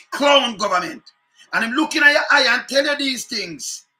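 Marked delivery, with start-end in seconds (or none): 0.1-2.8 loud contemptuous outburst; angry shout. Real, not acted. Host condemning the government on air, a loud angry shout. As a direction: spit it out loud as a verdict.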